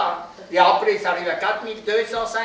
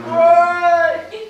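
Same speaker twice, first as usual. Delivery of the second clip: much higher, louder call as if from far below; far-off small voice; 10 semitones higher, 6 dB louder than usual. Acted. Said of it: "une petite voix qui monte depuis en bas".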